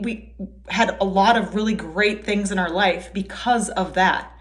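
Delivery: normal talking voice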